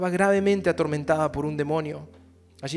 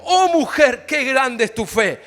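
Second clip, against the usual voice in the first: exalted proclamation; 7 semitones higher, 14 dB louder, slower than usual. Acted. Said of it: "respondió Jesús y dijo".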